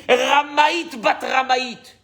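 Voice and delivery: high, drawn-out angry cry; heated accusation. Retold or imitated. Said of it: "ça a été chaud". (accusation enflammée, lente et aiguë)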